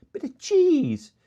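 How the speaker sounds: high, loud, slow drawn-out bit of cheese; hammed-up cheese craving; mock exclaim. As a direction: stretch it out like a grand pronouncement. Emphatic cheese.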